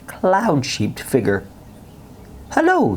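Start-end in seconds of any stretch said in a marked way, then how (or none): 2.5-3.0 put-on high, light child voice; lightly voiced young boy; bright little boy. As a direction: pitch it up like a small boy greeting. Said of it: "he said".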